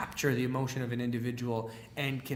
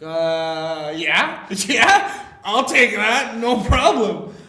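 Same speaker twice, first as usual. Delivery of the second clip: loud, high, laughing yeses; eager acceptance; 7 semitones higher, 12 dB louder, much slower than usual. Retold or imitated. New speaker offered seven hundred fifty bucks, eager acceptance. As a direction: jump at the offer, pitch shooting up. Acting his reply to a generous offer.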